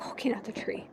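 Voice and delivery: breathy whisper, falling. Real, not acted. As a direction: whisper it, stunned. Whispered after strike.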